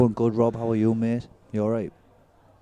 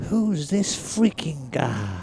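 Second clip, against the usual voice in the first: slow, higher mock American accent, stretched out; American accent impression; 6 semitones higher, much slower than usual. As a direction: drawl it like a Welshman faking an American. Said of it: "His American accent was mental".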